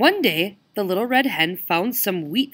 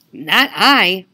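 louder, whining dog; 8 dB louder than usual. Acted. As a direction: whine it like a dog. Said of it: "whined the dog".

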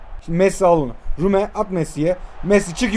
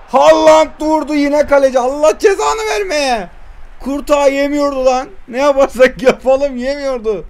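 frustrated shout; 5 semitones higher than usual. Real, not acted. Gamer cursing the goalkeeper's save, frustrated shout.

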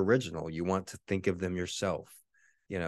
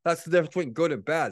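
mocking voice; 11 semitones higher, 5 dB louder than usual.

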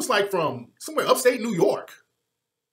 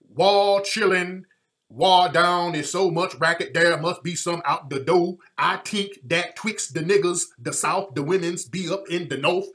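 slow, drawn-out mock plantation dialect; hammed-up old Southern plantation talk; 3 dB louder than usual. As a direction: exaggerate a crude plantation dialect reading. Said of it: "with all this plantation talk".

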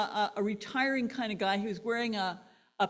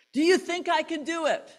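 asking crowd; 5 semitones higher, 4 dB louder than usual. Retold or imitated. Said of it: "Blondin asked the crowd".

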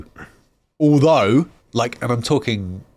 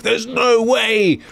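high, slow mock protest; mock outrage; 11 semitones higher, much slower than usual. Mocking imagined fans objecting to the casting. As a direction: stretch it out in fake disbelief. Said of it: "No one's like".

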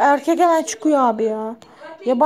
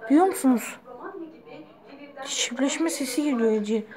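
normal talking voice

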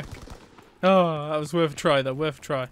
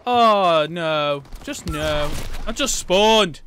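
loud dismay; 7 dB louder, much slower than usual. Real, not acted. Dismayed after respawn death.

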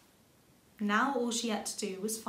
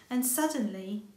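ordinary delivery

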